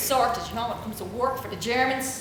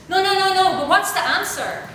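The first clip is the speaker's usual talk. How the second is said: pleading student; 8 semitones higher, 5 dB louder than usual. Retold or imitated. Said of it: "how many times do you ask me in class".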